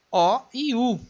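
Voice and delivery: normal talking voice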